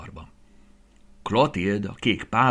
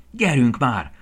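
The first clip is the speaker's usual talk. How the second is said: urging; 5 semitones higher, 7 dB louder, slower than usual. A narrator's reading. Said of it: "nógatta Bóbita".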